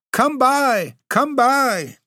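very high, shrill goblin hawking, falling at the end; goblin cry. Acted. Goblins calling to the maids to buy fruit, shrill high cry. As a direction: screech it like a goblin peddler luring buyers. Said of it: "with their shrill repeated cry".

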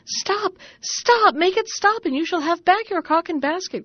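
high panicked begging to stop; frantic cries. Acted. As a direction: yelp as if being beaten. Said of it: "cried the man and his wife".

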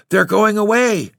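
high, loud alarmed cry. A narrator's reading. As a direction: call it out high in dismay. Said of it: "the boys cried".

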